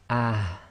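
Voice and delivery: "ah" breathed softly from the bottom of the throat; a light "ah" from low in the throat; soft ha from deep throat. Shown as a demonstration. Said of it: "from the bottom of your throat".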